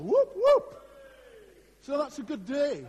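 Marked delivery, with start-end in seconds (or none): 0.0-0.9 high, loud football cheer; cheering whoop. Real, not acted. Preacher celebrating his team's derby win, loud cheering whoop. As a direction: whoop it up like a fan on the terraces.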